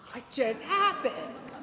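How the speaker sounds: slow, amazed, half-laughing question; astonished, with a laugh. Retold or imitated. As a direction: daughter stunned her dying mother answered, slowly amazed. Daughter amazed mother responded.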